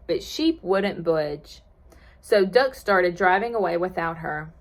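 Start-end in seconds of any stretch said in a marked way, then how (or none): none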